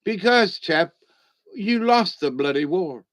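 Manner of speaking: put-on light British accent, a touch posh on chap; a hint of a clipped British pilot. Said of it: "in a beautiful British accent".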